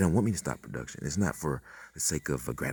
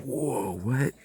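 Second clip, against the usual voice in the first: high breathless whisper of shock; shocked breathy whoa; stunned whisper; 10 semitones higher than usual. Retold or imitated. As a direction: whisper it in disbelief. Stunned reaction retold.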